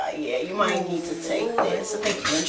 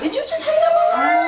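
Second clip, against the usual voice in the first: much higher, louder, fast disbelief; shocked outburst; 8 dB louder, faster than usual. Real, not acted. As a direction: blurt it out in shock. Shocked he hung up on her.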